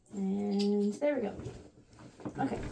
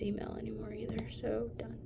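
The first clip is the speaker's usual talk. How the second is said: whisper; 11 dB quieter than usual. Real, not acted. Whispered aside while sorting emails.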